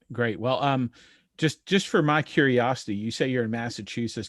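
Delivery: ordinary talk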